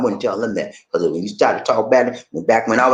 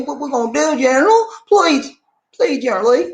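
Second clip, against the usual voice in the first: very high, louder, wailing begging; desperate plea; 4 dB louder than usual. Retold or imitated. A soldier begs General Lee to save the flag, high wailing plea. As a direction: cry out please like a desperate child.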